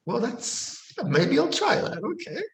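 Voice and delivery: high, unsure agreeing voice; hesitant imitation. Man hesitantly agreeing to try tea, high and unsure.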